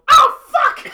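sudden high loud yelp; pained yelp. Acted. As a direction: cry out as you get hit. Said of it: "You hear one asshole go".

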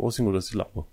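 normal talking voice

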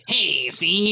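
exaggerated old-school Chicago talk; old-school Chicago accent. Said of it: "like, old school Chicago accents?".

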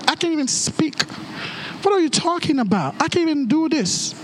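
ordinary talk

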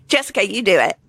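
usual speaking voice

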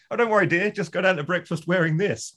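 high mock-suave Frasier; Frasier quote. Mocking Frasier offering his date the robe, high and sweet.

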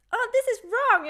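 loud, high mock complaint; angry guest. Annoyed guest complaining about a drink, loud and high.

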